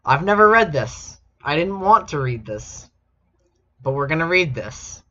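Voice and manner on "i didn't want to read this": usual speaking voice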